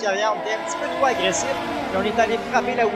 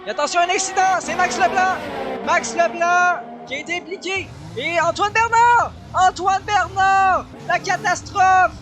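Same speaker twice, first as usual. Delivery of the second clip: motorsport commentator; 6 semitones higher than usual. Real, not acted. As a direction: sell the crash at full voice. Crash called live. (commentateur qui annonce l'accident avec éclat)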